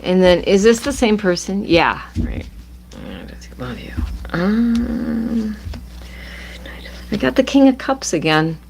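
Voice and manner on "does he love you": hushed whispered question; whispering. Real, not acted.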